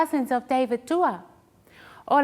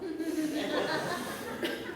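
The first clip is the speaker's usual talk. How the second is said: breathy laugh; 13 dB quieter than usual. Real, not acted. Laugh after quote.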